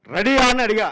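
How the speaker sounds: imitated angry director; mock director scolding. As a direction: demand an answer impatiently. Retold director scolding assistant.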